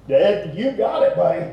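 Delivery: acted, emphatic enthusiasm; enthusiastic. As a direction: hand it over with gusto. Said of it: "I mean, there's enthusiasm here".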